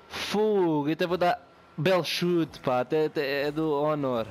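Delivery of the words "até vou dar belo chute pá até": ordinary talk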